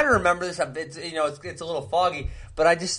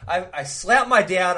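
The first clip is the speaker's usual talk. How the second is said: ordinary talk, nothing marked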